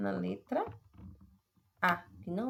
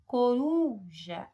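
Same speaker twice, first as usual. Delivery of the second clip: RU of "Coruja" loud and long; stressed middle syllable; 3 semitones higher, 6 dB louder, much slower than usual. Shown as a demonstration. Teacher demonstrating the tonic syllable, RU in "Coruja" stressed. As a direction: stretch and lift the RU in "Coruja". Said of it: "a penúltima sílaba".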